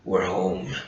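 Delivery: low quiet movie-hero imitation; Han Solo line. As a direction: deliver it like a weary hero coming home. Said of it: "Harrison Ford just goes with that line".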